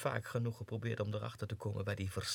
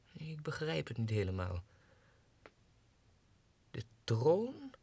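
normal talking voice

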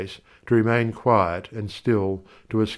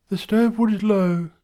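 much higher voice for the wife, mumbled; mumbling wife; 11 semitones higher than usual. Acted. Wife with pegs in her mouth, high mumble. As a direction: mumble as if round clothes pegs. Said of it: "she mumbles".

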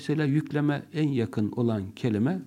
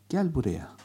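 tender call. Shown as a demonstration. Teacher demonstrating an affectionate call to come here. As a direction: coax kindly. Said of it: "Bu bir şefkat ifadesidir".

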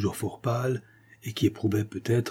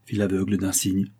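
ordinary delivery

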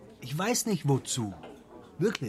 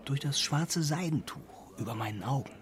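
whispered confession; 5 dB quieter than usual. Acted. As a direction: drop to a whisper, secretive. Confiding a secret. (geheimnisvoll geflüstert)

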